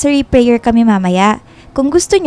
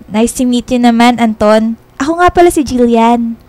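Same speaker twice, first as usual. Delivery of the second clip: ordinary delivery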